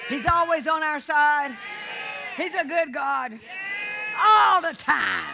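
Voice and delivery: loud, rising shouted praise; preaching at full force. Real, not acted. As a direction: call it out to the church.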